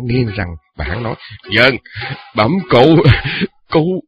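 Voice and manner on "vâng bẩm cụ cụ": put-on high, loud drunk menace; drunken threat. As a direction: threaten him, slurred and loud.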